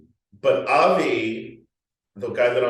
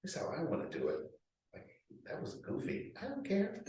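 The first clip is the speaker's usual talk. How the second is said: defiant inner voice; 5 semitones higher, 11 dB quieter than usual. Retold or imitated. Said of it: "You're like".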